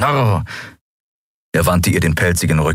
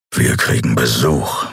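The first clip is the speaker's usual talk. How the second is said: lowered, gruff warning growl; low growl; 7 semitones lower than usual. Acted. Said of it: "knurrte er". (tief geknurrte Warnung)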